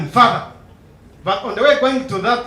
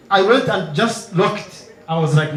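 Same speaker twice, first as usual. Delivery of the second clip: ordinary talk, nothing marked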